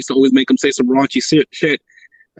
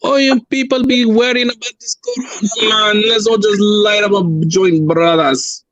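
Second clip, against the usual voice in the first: hammed-up Jamaican accent, pitched up at first; 5 semitones higher than usual. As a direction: do a bad, drawn-out Jamaican accent. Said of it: "Appropriate a Jamaican accent".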